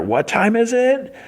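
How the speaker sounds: very high, drawn-out call; hyped catchphrase call. Retold or imitated. Champion calling to his crowd, high hyped call. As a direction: call it out high, stretching the words.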